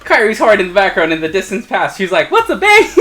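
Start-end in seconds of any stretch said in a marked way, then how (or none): 2.3-2.9 high, louder innocent question; naive girl voice. Retold or imitated. Mocking the story for a friend, voices Kairi high and clueless.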